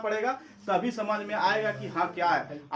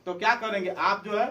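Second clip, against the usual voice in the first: usual speaking voice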